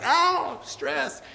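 high, squeaky mock fret; mock panic. Pastor retells his worry before the sermon, high mock fret. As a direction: squeal it like a nervous joke. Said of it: "I was like".

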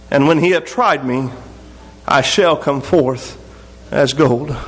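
low, unhurried reading of Job; scripture reading. Acted. Preacher reads Job's lament to the congregation, low and solemn.

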